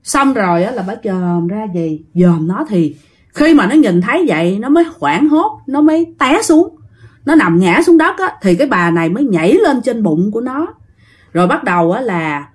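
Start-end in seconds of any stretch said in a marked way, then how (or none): none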